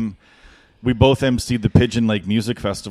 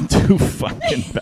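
laughing; slower than usual. Real, not acted. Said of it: "I don't know why I talk like this".

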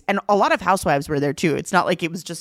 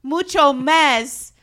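high, loud, slow mock-Spanish accent; Mary's fake Spanish; 8 semitones higher, 5 dB louder, much slower than usual. Imitating Mary instructing her housekeeper, in fake Spanish accent. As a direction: stretch every word in badly faked Spanish. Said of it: "I speak to her in Spanish".

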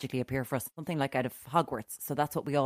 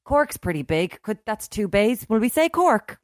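higher, louder mock-dim quiz contestants; clueless quiz team; 6 semitones higher, 6 dB louder than usual. Retelling quiz team's guess as a hammed-up dim voice. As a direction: ham up the baffled students guessing. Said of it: "And they went".

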